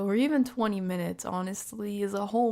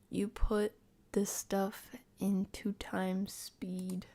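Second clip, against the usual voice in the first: very slow, subdued delivery; drawn-out, softer reading with gaps; slow, hesitant reading; 5 dB quieter, much slower than usual. Shown as a demonstration. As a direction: read it out haltingly, word by word. Reading one take, then another.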